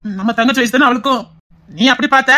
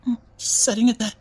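hurt, tearful; 3 semitones lower, 8 dB quieter, slower than usual. Acted. Hurt boy answers scolding aunt.

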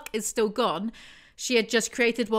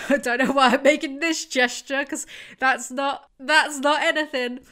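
higher, louder, laughing at herself; amused half-laugh through the words; giggling at a silly gesture; giggly; 5 semitones higher, 5 dB louder than usual. Real, not acted. Laughing at her own gesture.

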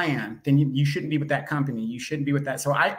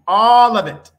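much louder, higher showy voice; big, high, performed flourish; Trey King; 8 semitones higher, 13 dB louder, slower than usual. Acted. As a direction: boom it out in a put-on voice. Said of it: "as you go in your Trey King voice".

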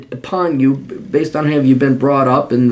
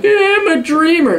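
mocking an immigrant's claim in a much higher voice; mocking caricature voice. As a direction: squeal it in a high mocking caricature.

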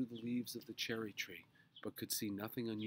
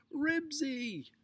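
high, loud drawn call; yelled name; 10 dB louder than usual. A narrator's reading. Henry chasing his dog, high yell. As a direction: yell the dog's name down the street. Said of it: "yelled Henry".

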